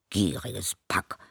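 slow grumble under the breath; grumbling. A narrator's reading. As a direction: mutter the insult slowly. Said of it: "schimpfte er".

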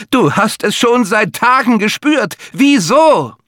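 high, slow accusing shriek; the gut screaming I told you so; shrieking inner voice. Acted. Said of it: "kreischte das Affenhirn".

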